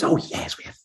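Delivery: soft whisper; whispered reply. Retold or imitated. Liz answering him about the fun, whispered.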